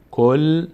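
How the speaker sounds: کل as "kol", resting on the l; closed syllable. Shown as a demonstration. Teacher demonstrating the sakin reading, closed syllable. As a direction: hold کل on its vowelless l. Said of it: "به صورت ساکن".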